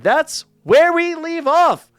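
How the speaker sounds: high, loud, drawn-out announcement; showy cliffhanger wrap-up; big sign-off. Real, not acted. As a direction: belt out the closing line, stretched and high. Theatrical sign-off of the recap.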